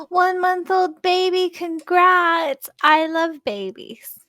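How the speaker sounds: high-pitched cooing congratulations; gushing. Real, not acted. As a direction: gush over a newborn, drawn out and high. Gushing over a viewer's baby.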